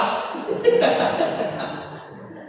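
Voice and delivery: a long breathy laugh that jumps up high; amused laugh at his own slip; high airy laugh. Real, not acted. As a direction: burst into a high, voiceless laugh. Laughs after joke.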